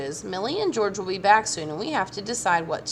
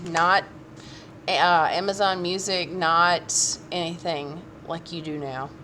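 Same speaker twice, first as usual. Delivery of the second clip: ordinary delivery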